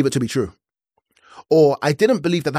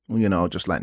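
ordinary talk, nothing marked

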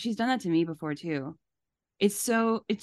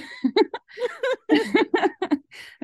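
high laugh; 12 semitones higher, 4 dB louder than usual. Real, not acted. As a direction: burst of high, real laughter. Hosts laughing at the message.

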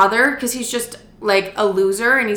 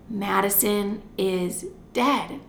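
slow, halting, softer delivery; deliberate death announcement; 6 dB quieter, much slower than usual. Retold or imitated. Teenager awkwardly telling sister her friend died, slow and deliberate. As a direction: drop the news slowly, word by word. Retold breaking of death news.

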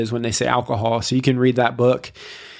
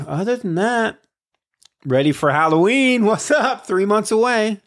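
excited; 9 semitones higher than usual. Real, not acted. Cheerful Halloween greeting.

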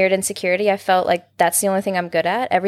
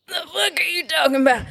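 friends mocking her; 8 semitones higher than usual. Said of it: "will be like".

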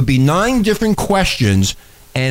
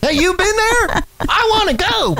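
mock yokel. Joking with co-host about New York City, mock yokel. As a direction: squeal it like a wide-eyed country bumpkin.

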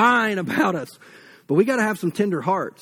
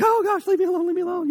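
high-pitched panicky mock whine; cowardly lion yelling; 10 semitones higher, faster than usual. Cowardly lion begging Tin Man to leave him alone, high-pitched mock panic. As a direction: squeal it like the cowardly lion fleeing. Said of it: "He's, like, yelling at him".

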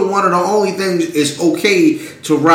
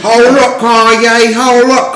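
higher, raised-voice interjection; interrupting host; 6 semitones higher, 4 dB louder, slower than usual. Retold or imitated. Radio host interrupting [name]'s rant, raised and insistent. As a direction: cut in to calm a ranting guest.

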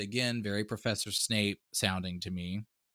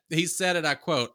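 ordinary delivery

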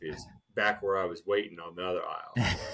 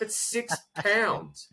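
slow, much higher mock repeat, stretched out; mocking drawn-out disbelief; 12 semitones higher, much slower than usual. Complaining to his buddy, mocking his wife's six pounds of flour, slow and high.

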